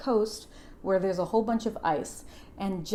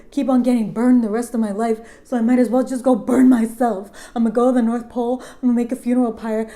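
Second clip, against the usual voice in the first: acted despairing rant; 2 semitones higher, 7 dB louder than usual.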